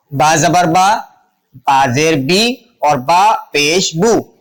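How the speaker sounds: measured recital, "با" as baa, "بی" as bee, "بو" as boo; vowel-mark pronunciation drill. Shown as a demonstration. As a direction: spell each out slowly and stretch the resulting syllable. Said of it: "یہ ہے حرکات کا تلفظ".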